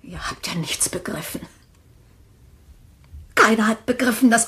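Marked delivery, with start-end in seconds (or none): 0.0-1.4 hushed, breathless whisper. Acted. Widow whispering her secret to the family. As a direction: let it slip out under the breath.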